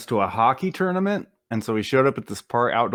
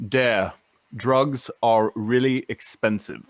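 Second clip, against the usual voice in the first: put-on slow, deadpan Welsh accent; slower than usual. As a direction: Welsh accent, dry and unhurried. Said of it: "in his Welsh, English Welsh accent".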